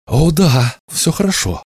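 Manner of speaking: put-on high-pitched, lively young hero; eager reply. A narrator's reading. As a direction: answer eagerly, pitch jumping right up. Hero answers wizard in story. (восторженный ответ, высоким голосом)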